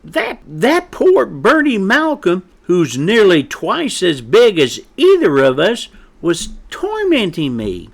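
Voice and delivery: high, loud, indignant chick; angry peeping. A narrator's reading. Chick corrects his mother's story, angrily. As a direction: protest hotly, voice jumping up. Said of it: "Pep angrily peeped".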